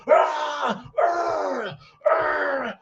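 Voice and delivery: repeated high mock hollers; imitated yelling. Couple fighting heard through the floor, imitated yelling. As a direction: mimic wordless yells through a floor. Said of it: "you hear there's yelling going on".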